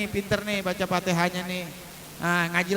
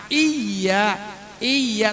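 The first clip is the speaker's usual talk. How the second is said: "Iya" with a firmly held, stressed y; pressed doubled consonant. Shown as a demonstration. Teacher showing the tasdid, "Iya" pressed on the y. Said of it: "Maka dibacanya ada penekanan".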